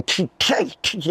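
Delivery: high squeaky chain-on-glass noises; imitated chain tapping. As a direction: mimic the necklace clacking the window. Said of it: "you can hear it all going".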